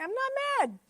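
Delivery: defensive denial. Retold or imitated. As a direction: insist you're not mad in a high, defensive voice. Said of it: "I'm like".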